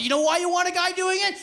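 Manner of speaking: usual speaking voice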